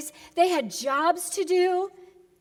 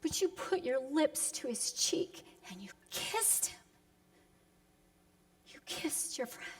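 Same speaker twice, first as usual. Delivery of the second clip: whispered reproach; soft unvoiced whisper of betrayal; 10 dB quieter than usual. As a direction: breathe it out, wounded. Hushed reproach to Judas.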